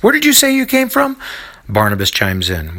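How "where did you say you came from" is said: eager vendor. A narrator's reading. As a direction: coax the strangers like a market hawker. Vendor questioning the travellers.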